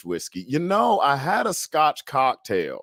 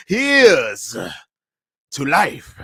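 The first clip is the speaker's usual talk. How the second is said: toast climax; 2 semitones higher, 5 dB louder, much slower than usual. Acted.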